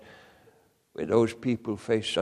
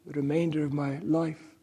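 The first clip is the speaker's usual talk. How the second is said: crying out; 8 semitones higher, 5 dB louder than usual. Retold or imitated. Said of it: "And he cried".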